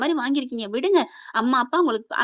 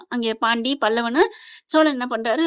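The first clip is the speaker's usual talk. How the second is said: usual speaking voice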